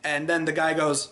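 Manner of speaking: normal talking voice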